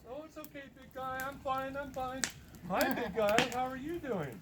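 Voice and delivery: high-pitched mock woman's voice; anxious woman reassuring. Scared woman telling growling tree she's fine, high imitation. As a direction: imitate her placating a big creature in a high voice.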